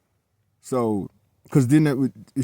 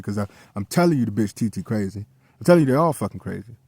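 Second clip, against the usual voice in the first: usual speaking voice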